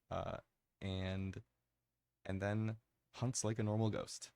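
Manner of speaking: ordinary talk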